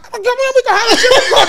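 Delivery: high, fast, laughing eagerness; excited laughing quote. Retold or imitated. Retelling One Punch's eager offer, high and laughing. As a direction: rush it out, laughing.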